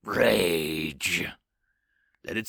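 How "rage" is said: terrifying yell. A narrator's reading. Giant creature crushing the demon, terrifying roaring yell. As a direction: bellow the word like a monster. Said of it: "yells with a terrifying force".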